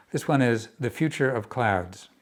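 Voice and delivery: ordinary delivery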